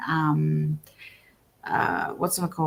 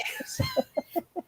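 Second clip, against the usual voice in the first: high laugh; 7 dB quieter than usual. Real, not acted. Laughing after giving her details.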